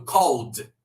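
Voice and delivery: "called" with the L swallowed into an O sound, like "cold". Shown as a demonstration. Teacher showing students that "called" sounds like "cold". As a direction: draw out "cold" slowly, L turned to O. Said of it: "A L juntinhos vai ter som de O".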